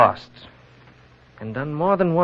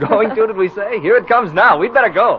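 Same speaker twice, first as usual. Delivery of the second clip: laughing excitement; 8 semitones higher, 7 dB louder than usual. Acted. Snow suddenly arrives, laughing.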